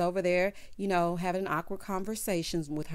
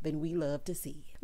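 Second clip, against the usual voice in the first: ordinary talk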